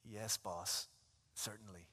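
low, soft, submissive answer; meek servant. Acted. Servant meekly answering the old boss, low and soft. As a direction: shrink and obey. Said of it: "Do you say".

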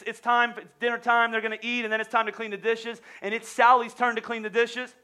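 ordinary delivery

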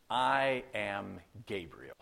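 slow, low, weighty self-announcement; emphatic angel. Retold or imitated. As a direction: draw out each word like an angel declaring himself. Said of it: "very emphatically".

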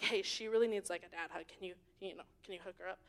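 soft, conspiratorial ask; hushed aside. Retold or imitated. Speaker asking the pastor on the side, softly and hushed. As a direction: lean in and ask it quietly.

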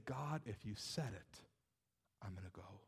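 low, hushed yielding to God; quiet surrender. Acted. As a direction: drop very soft and low, resolved. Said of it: "suspend the what ifs and say".

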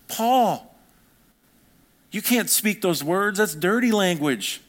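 high, drawn-out mock outrage; mock-shocked scolding. Preacher pretending to scold Paul for dirty language, mock-shocked.

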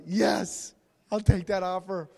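loud, thrilled acceptance; excited yes. Retold or imitated. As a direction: blurt it out overjoyed. Girl accepting surprise pony offer.

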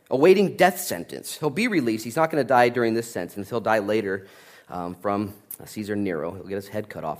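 usual speaking voice

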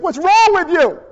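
high, emphatic scolding question; mock exasperation. Gabriel answers Mary's questions with hammed-up exasperation. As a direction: throw up the question in disbelief.

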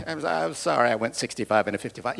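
quiet, low and rushed, nervous to the judge; nervous defendant. Retold or imitated. Pleading with the traffic judge, low and hurried. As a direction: mumble an apology to the judge. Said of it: "you're nervous".

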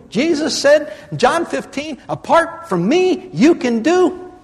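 high, urgent preacher's push; full-force preaching. Acted. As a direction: drive it home from the pulpit. Preaching to the congregation.

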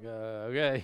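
uneasy agreement. Retold or imitated. Man asked to share his seat, uneasy. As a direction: agree warily, as if it's getting weird. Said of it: "kinda getting weird now".

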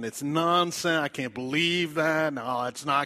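forceful voice of a doubter; loud mock denial. Preacher voices doubters in the congregation, loud dismissive denial. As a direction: push it away loudly, word by word. Voicing doubters who push it away.

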